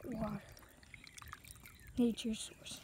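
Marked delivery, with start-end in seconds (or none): 2.3-2.8 soft whispered aside; whispering. Real, not acted. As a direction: whisper it to yourself. Unvoiced aside.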